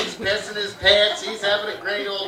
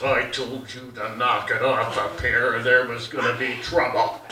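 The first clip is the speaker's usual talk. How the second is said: a hint of a stern father scolding; stern dad; 8 semitones lower, 5 dB quieter than usual. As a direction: growl it down at the kid. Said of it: "My dad looks at my brother Dave and goes".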